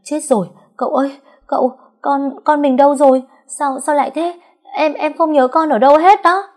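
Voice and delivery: high, loud, fast panicked wail. A narrator's reading. Mother realising her son is missing, panicked high wail. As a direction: cry out in terror for the lost child. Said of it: "cô gào lên".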